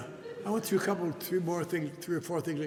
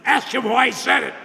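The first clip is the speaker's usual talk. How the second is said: louder, higher, insisting; firm insistence; 8 semitones higher, 14 dB louder than usual. Retold or imitated. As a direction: repeat it firmly, no argument. Sponsor repeats, firmer.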